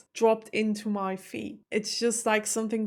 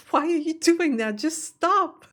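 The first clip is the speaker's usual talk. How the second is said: higher and louder, fed-up demand; exasperated outburst; 6 semitones higher, 5 dB louder than usual. Real, not acted. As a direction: snap at them to stop spoiling. Ranting at the show makers.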